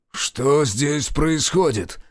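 sharp, slow, high bark; barked question. A narrator's reading. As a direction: bark it like a furious sergeant. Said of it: "рявкнул побагровевший сержант".

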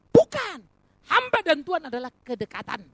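high, loud shouted denial, then firm proclamation; impassioned preacher's correction; emphatic rebuttal. Real, not acted. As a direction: cut the idea down forcefully. Preacher rebutting a wrong idea.

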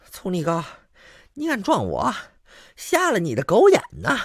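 high, thin little rogue's voice; the rogue Hao Xuan cursing in his thin squeaky voice. Acted. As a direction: pipe it up in a reedy squeak. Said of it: "一张嘴还是一小细嗓儿".

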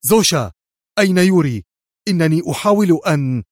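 agitated caller, cut off mid-sentence. A narrator's reading. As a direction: call out urgently, agitated and on edge. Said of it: "يقول في عصبية شديدة".

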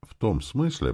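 ordinary delivery

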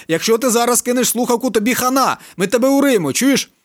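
high, loud threat down the phone; bully him over the phone; threatening shout. A narrator's reading.

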